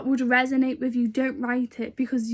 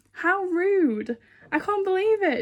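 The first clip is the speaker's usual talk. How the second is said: higher and slow, scandalised at herself; a drawn-out how rude, half laughing at herself; mock outrage; 7 semitones higher than usual. Real, not acted. Looking back on her old ungrateful self, mock outraged. Scolding her own past ingratitude.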